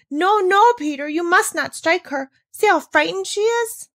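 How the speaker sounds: high, loud pleading cry; cried out. Acted. Girl begging Peter not to strike the goat, crying out. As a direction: cry out to stop him. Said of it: "But Heidi cried out".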